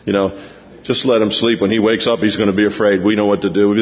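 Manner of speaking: lightly voiced disciples sneering; smug fishermen. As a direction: talk down about the carpenter. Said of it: "they probably think".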